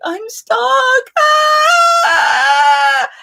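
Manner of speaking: high wail rising to a shout; desperate cry. Retold or imitated. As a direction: cry out for rescue, then scream. Stuck, calling out for help.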